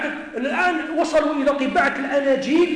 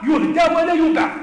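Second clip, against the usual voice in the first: loud; 5 semitones higher, 9 dB louder than usual. Real, not acted. Repeats the label louder.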